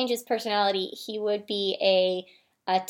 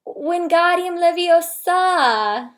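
loud, drawn-out British spell-casting; hammed-up British wizard incantation; British accent; 7 semitones higher, 8 dB louder, much slower than usual. Shown as a demonstration. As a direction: cast it slowly in a fake British accent. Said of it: "Can I try again?".

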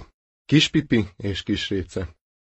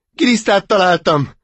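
high, louder joyful cry; rejoicing; 8 semitones higher, 5 dB louder than usual. Acted. Duckling proudly finding a worm, joyful cry. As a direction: shout the find with delight. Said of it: "újongott".

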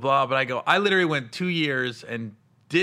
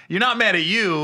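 usual speaking voice

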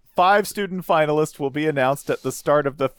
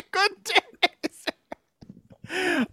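Willy Wonka dismissal; 5 dB quieter, much slower than usual. Pretend. Wonka quote echo.

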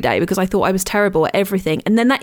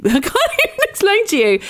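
laughing; 11 semitones higher, faster than usual. Real, not acted. Laughing at her own struggle.

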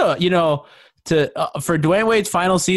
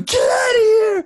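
high, drawn-out mock yell; imitating mom yelling; 4 dB louder than usual. Quoting his mom yelling at LeBron, high mock yell. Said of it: "She was just yelling at him".

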